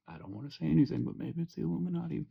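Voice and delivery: mock-conspiratorial murmur; hushed conspiracy. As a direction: drop "Illuminati" like a hushed secret. Repeats line as hushed conspiracy.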